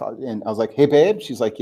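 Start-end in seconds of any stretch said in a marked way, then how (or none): 0.7-1.2 calling out. Retold or imitated. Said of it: "I yell downstairs to my wife".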